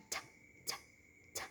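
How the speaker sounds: "t" as a bare tongue tap of air; unvoiced T. Shown as a demonstration. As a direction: tick out "t" with no voice. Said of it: "Is unvoiced".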